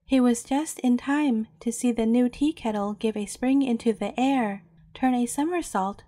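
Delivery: plain reading voice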